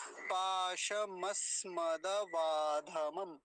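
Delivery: पाशमस्मदवाधमम slowly, long vowels held; joined-up slow model. Shown as a demonstration. Teacher shows how the whole word is formed, slowly joined. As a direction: join the parts into one measured word. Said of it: "पूरा मिलाएंगे कैसे बनेगा".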